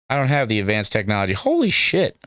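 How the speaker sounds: ordinary delivery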